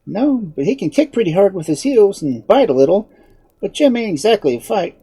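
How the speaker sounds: put-on high-pitched little girl; Dorothy. A narrator's reading. Girl defending her horse to unseen man, high and frank. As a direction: answer frankly in a girlish high voice.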